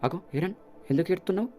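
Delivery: put-on a girl suddenly noticing her brother's tears; surprised Mikasa. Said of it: "మికాసా".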